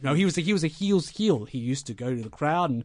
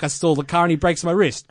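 ordinary talk, nothing marked

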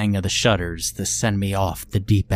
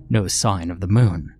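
usual speaking voice

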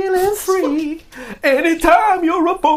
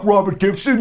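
usual speaking voice

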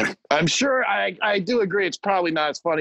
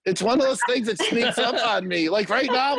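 ordinary talk, nothing marked

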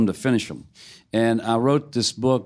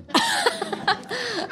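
high burst of laughter; a quick high laugh at the memory; 5 dB quieter than usual. Real, not acted. Laughs at wife's verdict.

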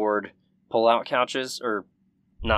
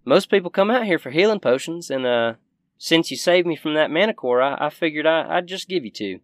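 higher folksy shopkeeper voice; grateful Southern shopkeeper; 7 semitones higher, 5 dB louder, faster than usual. Acted. Shopkeeper rewarding her rescuers, folksy and higher. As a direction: offer a reward in a higher country voice.

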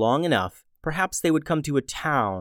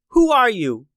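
startled call; 9 semitones higher, 6 dB louder than usual. Acted. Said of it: "she asked".

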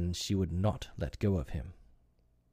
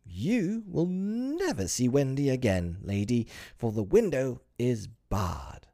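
drawn-out, high, loud gloating cry; exultant; 4 semitones higher, 4 dB louder, slower than usual. A narrator's reading. Peter gloating at Wendy's mother, exultant. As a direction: crow it out slowly.

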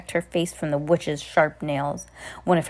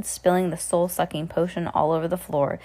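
plain reading voice

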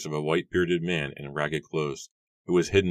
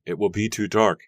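higher, louder note of caution; warning; 5 semitones higher, 6 dB louder than usual. A narrator's reading. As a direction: warn a cousin with raised voice. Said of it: "Mary warned".

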